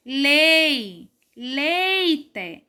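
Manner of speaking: LEI alone, then "leite" with LEI held; stress on LEI. Shown as a demonstration. Teacher repeating the strong syllable for pupils, long LEI in "leite". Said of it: "A sílaba mais forte é o lei".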